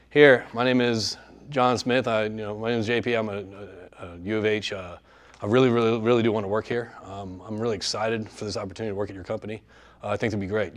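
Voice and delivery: low, subdued job pitch with no inflection; unenthusiastic. Acted. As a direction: mumble through an unexcited introduction. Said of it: "Do they sound exciting? I don't know".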